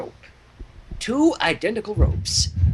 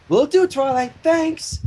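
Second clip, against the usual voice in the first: higher chipper Spike voice; voicing Spike; 9 semitones higher, 3 dB louder, slower than usual. A narrator's reading. Spike answers Twilight on his way out, chipper. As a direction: answer cheerfully, a bit higher.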